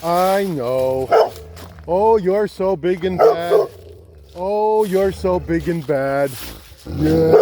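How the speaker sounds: high, slow teasing coo; talking to a dog. Real, not acted. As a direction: tease the dog lovingly. Talking to dogs.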